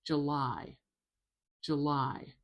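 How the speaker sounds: "July" with a weak "juh" and strong "LIE"; "July" said juh-LIE, long final vowel; last-syllable stress. Shown as a demonstration. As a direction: stress the end of "July", schwa first. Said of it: "stress is on the last syllable".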